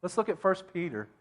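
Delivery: usual speaking voice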